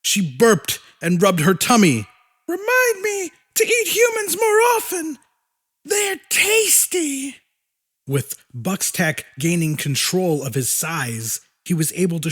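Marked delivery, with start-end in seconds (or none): 2.5-7.3 high monster voice. A narrator's reading.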